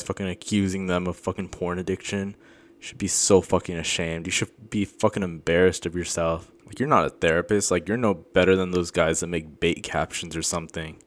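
usual speaking voice

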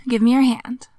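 ordinary talk, nothing marked

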